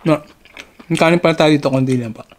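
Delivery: talking while chewing; chewing between bites; mouth partly full. Real, not acted. Seen 0: Mouth looks busy chewing after chicken bites, while he scoops rice.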